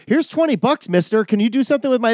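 put-on high, eager little-kid voice; voicing the son, handing over cash. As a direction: pitch it up like a young son asking. Son voiced in retold story.

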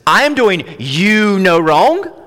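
"I am doing you no wrong" high, loud and drawn out, stretching "you"; very slow, insistent stress with a rising "wrong"; heavy emphasis. Shown as a demonstration. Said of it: "That's the emphasis".

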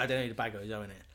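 ordinary talk

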